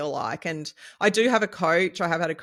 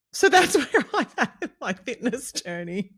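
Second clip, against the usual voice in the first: laughing; 8 semitones higher, 4 dB louder than usual. Real, not acted. Wrapping up with a laugh.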